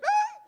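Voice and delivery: very high, loud gasp; gasping wake-up scream. Retold or imitated. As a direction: jolt awake choking. Waking from apnea choke.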